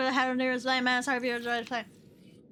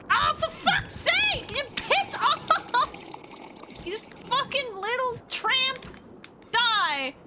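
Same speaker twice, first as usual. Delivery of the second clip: furious outburst; 11 semitones higher, slower than usual. Real, not acted. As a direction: curse them out at full pitch. Attacked by creatures in game.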